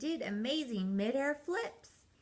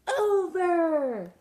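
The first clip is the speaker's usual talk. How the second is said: loud, higher shouts of over, under, in between; shouting; 9 semitones higher, 9 dB louder, much slower than usual. Acted. Peg and Cat shout directions while skiing after penguins. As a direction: yell each word down the slope. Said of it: "skied along shouting".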